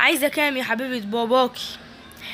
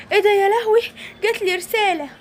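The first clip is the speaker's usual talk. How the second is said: alarmed gasp; 6 semitones higher than usual. Acted. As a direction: jump at the shock. Mother alarmed by message.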